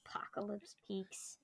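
hushed whisper of "Apocalypse Peaks". Real, not acted. Echoes the scary place name in a whisper. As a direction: breathe the name out in awe.

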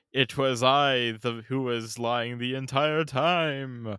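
hammed-up villain confessing a grand deception; mock villain. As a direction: stretch it out theatrically, then cackle. Mock villain confession with evil laugh.

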